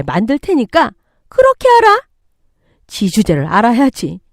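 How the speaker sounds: loud, high-pitched bullying retort; angry threat. Acted. Mother-in-law threatening defiant daughter-in-law, angry. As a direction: overrule her with hard stresses.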